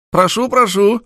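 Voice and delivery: high, loud eager invitation; effusive welcome. A narrator's reading. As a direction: usher him in with gusto. Manager ushers him in.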